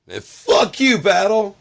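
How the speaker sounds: very high, full-volume shout; imitated high screaming heckle; heckler's yell. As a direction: holler it across the street. Imitates heckler yelling across the street.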